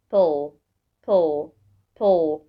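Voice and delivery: "pull" repeated alone with pauses; isolated last syllable. Shown as a demonstration. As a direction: sound out "pull" as one separate syllable. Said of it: "تقطعها لكام مقطع". (نطق المقطع "pull" منفصلًا ومكررًا)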